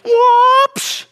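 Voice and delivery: high, loud whoop ending in an impact noise; imitated uppercut whoosh. Preacher acts out the cat's finishing uppercut, loud whoosh. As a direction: mimic a martial-arts movie blow. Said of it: "Chuck Norris".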